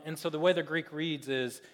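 ordinary talk, nothing marked

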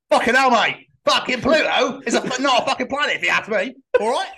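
loud, high mock southern English accent; outraged southern English bloke, hammed up. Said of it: "Is that his south and southern accent?".

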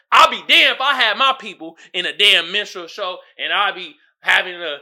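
usual speaking voice

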